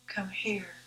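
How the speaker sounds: soft whispered plea to the Lord; whispered prayer. Acted. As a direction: breathe the last words as a hushed prayer. Closing prayer fades to whisper.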